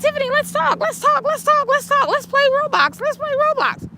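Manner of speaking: put-on high, childlike voice of a small boy; little nephew voice. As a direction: pitch way up like a little kid begging to play. Imitating baby nephew.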